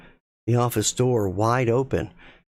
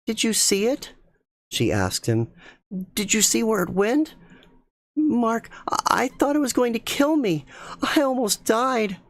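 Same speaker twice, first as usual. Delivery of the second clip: high, shaken and terrified; frightened; 11 semitones higher than usual. Acted. Rachel still shaking, frightened.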